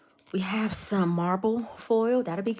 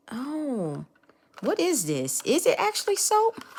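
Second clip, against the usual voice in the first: high, loud disbelieving question; amazed squeal; 9 semitones higher, 3 dB louder than usual. Real, not acted. Squealing in disbelief at the rose soap.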